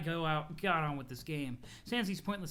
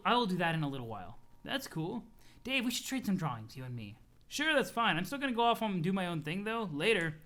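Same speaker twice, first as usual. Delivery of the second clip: usual speaking voice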